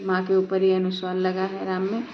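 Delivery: usual speaking voice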